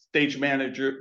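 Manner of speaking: ordinary delivery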